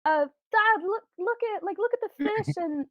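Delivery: put-on high, eager little-kid voice; excited child. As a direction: play a child pointing things out to Dad. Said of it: "you hear your child laughing, and they're like".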